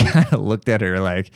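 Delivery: ordinary talk, nothing marked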